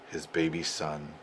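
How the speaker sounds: plain reading voice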